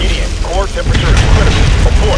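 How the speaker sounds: loud, fast urgent report; urgent shout. Acted. Operator to Gideon as the core overheats, shouting. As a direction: rush it out at full volume.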